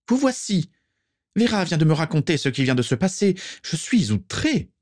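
high-pitched, outraged squawk; Prisca. A narrator's reading. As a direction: scold him in a piping, perched voice. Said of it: "piailla-t-elle de sa voix haut perchée". (piaillement outré, voix haut perchée)

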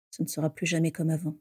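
low, muffled and hollow; a muffled voice, quick and drained. Acted. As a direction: say it into your chest, hollow. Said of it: "dit-il d'une voix sourde".

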